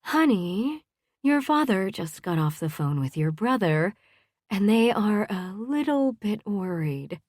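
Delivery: lilting, motherly explanation; coaxing mother. A narrator's reading. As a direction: break it to a daughter gently. Mother gently explains to daughter.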